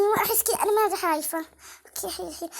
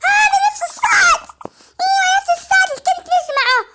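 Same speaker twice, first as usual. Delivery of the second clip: much higher, louder alarmed cry; alarmed shout; 10 semitones higher, 3 dB louder than usual. Real, not acted. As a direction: cry out that it is the same sound. Recognises the scary sound again.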